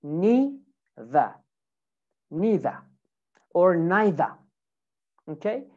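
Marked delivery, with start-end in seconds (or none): 0.0-2.8 British "neither" as "nee-thuh", no final R; British accent. Shown as a demonstration. Teacher models British "neither" for students, "nee-thuh". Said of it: "in British". 3.8-4.3 British "neither" as "nye-thuh"; British accent, NYE vowel. Shown as a demonstration. Teacher shows students the other British "neither", "nye-thuh". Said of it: "in British".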